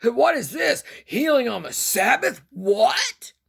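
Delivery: high, loud outraged protest; indignant hollering. Acted. Synagogue leaders protest the Sabbath healing, high and loud. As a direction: holler in disbelief like a scandalized elder. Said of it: "they hollered, were grumbling and complaining".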